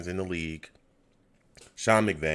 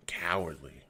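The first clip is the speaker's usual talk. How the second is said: softer, a whispered single word; whispered verdict; 4 semitones lower, 8 dB quieter than usual. Real, not acted. Fan whispering his verdict on the coaches. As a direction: breathe the word out in disgust. Fan muttering about scared play.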